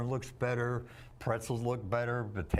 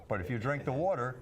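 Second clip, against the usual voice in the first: usual speaking voice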